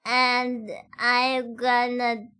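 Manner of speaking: slowed, drawn-out talking; slow speech. Shown as a demonstration. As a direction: stretch every word out slowly. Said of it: "I'm gonna talk like this".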